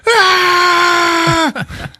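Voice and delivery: long, very high comic shriek; hammed-up scream of horror; mock terrified scream. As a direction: scream as if seeing a walking corpse. Janitor sees the walking corpse.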